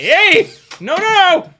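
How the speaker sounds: loud, high yelling at the dog to stop; alarmed shout. Real, not acted. Calling out loudly to the dog grabbing the drone.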